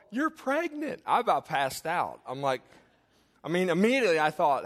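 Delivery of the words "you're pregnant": mimicked nurses, much higher pitch; high sing-out of happy news; nurses' joint announcement. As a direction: imitate a roomful of nurses announcing it. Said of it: "all of 'em".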